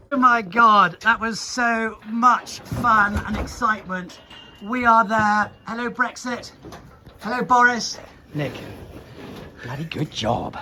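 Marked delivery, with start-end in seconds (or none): 0.1-8.0 excited. Real, not acted. Bull finally loaded.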